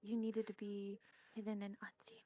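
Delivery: mock whisper back; teasing hushed reply. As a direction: whisper it like a conspirator. Mocking the whispered rebuke back.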